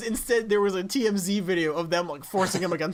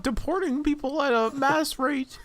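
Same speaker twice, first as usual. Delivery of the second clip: higher, louder puppet frog; Kermit voice; 6 semitones higher, 4 dB louder, slower than usual. Retold or imitated. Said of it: "Kermit the Frog comes out".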